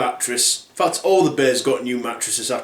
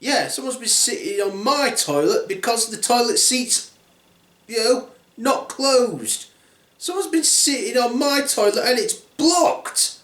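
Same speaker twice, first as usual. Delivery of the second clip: high-pitched indignant bear; complaining bear; 8 semitones higher than usual. Acted. Bear complaining about the blocked toilet, indignant. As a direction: grumble in a put-on high voice.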